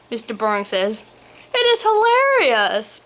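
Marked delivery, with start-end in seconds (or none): 1.5-3.0 very high, gleeful exclamation; pitched-up mocking delight; highly amused. A narrator's reading. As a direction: tease in a squeal-high voice. Son laughing at cursed father.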